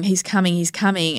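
ordinary talk

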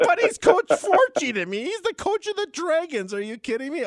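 high, loud, gushing over the coach; thrilled fan. Retold or imitated. Fan thrilled to reach the Dragons coach, high gushing. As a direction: gush like a starstruck fan.

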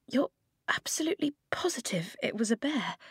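fully whispered, breathy and hushed; whispered question. Acted. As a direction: whisper it so nobody outside hears.

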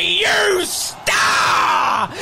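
high, drawn-out shout of praise; thrilled play-by-play cry; ecstatic commentator cheer. Acted. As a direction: holler it for the hero of the match.

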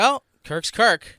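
slow, drawn-out mock resignation; quoting a colleague with a slow shrug; knowing shrug. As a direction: stretch it out like it explains everything. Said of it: "He said".

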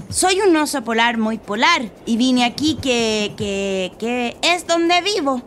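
nervous bluff. Acted. Boy bluffing to the bears.

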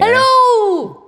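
high loud call into an echoey room; echoey shout. Real, not acted. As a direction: shout to hear the echo. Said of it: "That's why it's so echoey in here".

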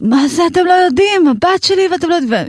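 high-pitched desperate retold outburst; a mother shouting in panic at the school; frantic yelling. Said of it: "ואני מתחילה לצ". (אם צועקת בבהלה על בית הספר, בקול גבוה)

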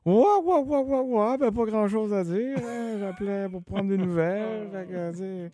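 voix aiguë et traînante d'un ami; message vocal de chum. Retold or imitated. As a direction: imiter un ami qui laisse un message vide. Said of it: "puis que ton message, c'est". (voix aiguë de chum au répondeur)

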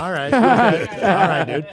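wary okay. Retold or imitated. Wary reply to stoner stranger.

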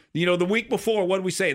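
ordinary delivery

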